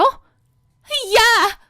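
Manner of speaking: high, sharp shout of the sister-in-law; sharp yell. Acted. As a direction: snap her name out high. (날카롭게 높이 지르는 고함)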